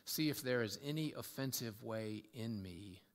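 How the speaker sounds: low, hushed prayer with long pauses. Acted. Preacher offering David's prayer to God, reverent. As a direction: pray it low and unhurried.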